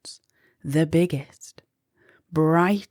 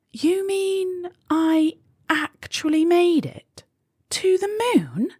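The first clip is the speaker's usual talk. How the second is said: much higher, amazed question; gasped in surprise; 11 semitones higher than usual. A narrator's reading. Molly hearing she really reached the moon, amazed. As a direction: gasp out the disbelief. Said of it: "gasped Molly".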